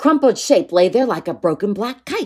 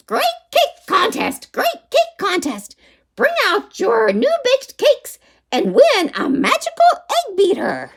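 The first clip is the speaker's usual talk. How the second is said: witch shouting. Acted. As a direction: call it out like a witch barker. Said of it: "started to shout".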